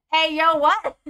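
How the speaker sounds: loud, high disbelieving outburst; startled outburst. Real, not acted. Player startled by what the game says, startled outburst. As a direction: burst out in real surprise.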